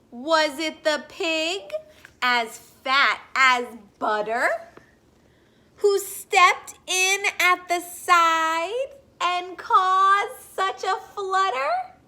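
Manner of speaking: slow, sing-song picture-book read-aloud; gentle storybook voice for little listeners; reading to children. Acted. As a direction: read it like story time on the rug.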